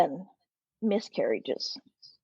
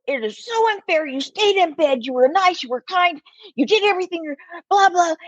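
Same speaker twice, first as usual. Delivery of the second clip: pitched up, outraged on a friend's behalf; indignant pity; 6 semitones higher than usual. Retold or imitated. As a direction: protest the unfairness, voice climbing. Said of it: "I just went".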